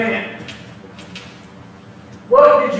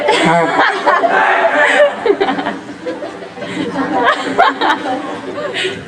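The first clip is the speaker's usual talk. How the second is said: mocking laugh; 7 semitones higher than usual. Mocking laugh after "kill him.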